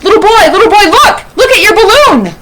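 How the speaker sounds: high, loud calling out; acted woman yelling across a crowd; excited shout. A narrator's reading. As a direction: shout urgently to get a boy's attention. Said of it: "Then a woman shouts".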